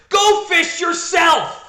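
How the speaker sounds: high, drawn-out mock yell; angry shout. Francine retorts to Brain at cards, furious mock shout.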